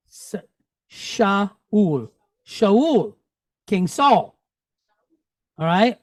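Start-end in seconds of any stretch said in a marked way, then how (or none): 0.9-3.2 "Shaul" with sh and two vowels, sha-ul; Hebrew name Shaul. Shown as a demonstration. Teacher blends syllables into the Hebrew name, clear and deliberate. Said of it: "how do we pronounce this part".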